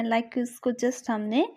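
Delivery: ordinary talk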